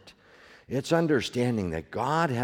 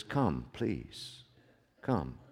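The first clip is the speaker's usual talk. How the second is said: soft, much quieter pleading call; tender plea; 7 semitones lower, 10 dB quieter, much slower than usual. Retold or imitated. Jesus calling his flock to come, soft and tender. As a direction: coax them gently to come. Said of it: "He speaks words of comfort".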